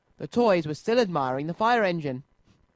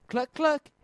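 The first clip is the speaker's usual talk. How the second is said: hen clucks; 9 semitones higher than usual. A narrator's reading. Hens answer from roof.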